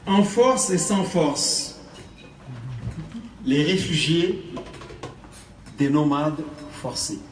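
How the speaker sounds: loud, slow spoken-word declamation with long pauses; poetry recitation. Acted. Poet to festival audience, a cry for refugees, loud declaimed recitation. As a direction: project each short phrase forcefully, then let it hang.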